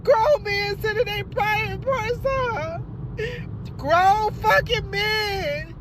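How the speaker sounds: ordinary talk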